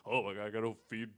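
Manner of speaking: ordinary talk, nothing marked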